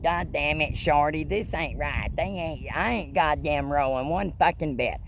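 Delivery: high, whiny buddy griping he ain't rolling; Wesley complaining. Retold or imitated. Friend in the car griping the mushrooms failed, high whine. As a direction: squeal it out like a let-down friend. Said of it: "Wesley was like".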